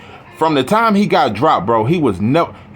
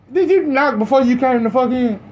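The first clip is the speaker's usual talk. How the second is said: mock scolding; 11 semitones higher than usual. Real, not acted. Scolding his dog playfully.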